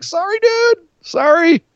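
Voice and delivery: high-pitched mock apology; put-on high voice for a fake apology; Edge. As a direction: squeal a hammed-up sorry. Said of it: "betrays Orton ... and was like".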